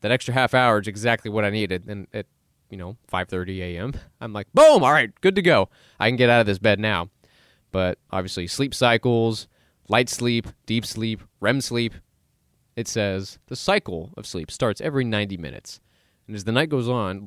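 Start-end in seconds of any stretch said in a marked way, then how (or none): none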